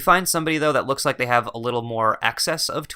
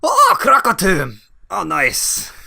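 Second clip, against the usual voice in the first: delighted surprise; 7 semitones higher, 8 dB louder, slower than usual. Pretend. Dwarf delighted at hometown name.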